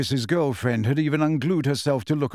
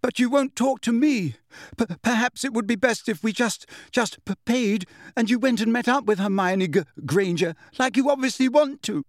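tearful jealous stammer; 9 semitones higher than usual. A narrator's reading.

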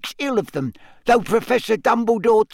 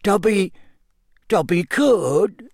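halting, higher elf voice, rising uncertainly; doubtful; 7 semitones higher than usual. A narrator's reading. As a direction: hesitate and drag it out doubtfully. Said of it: "he said doubtfully".

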